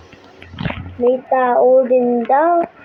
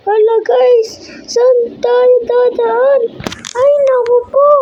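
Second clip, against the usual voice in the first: panicked; 7 semitones higher than usual. Real, not acted. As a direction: panic while running away. Still fleeing the chef.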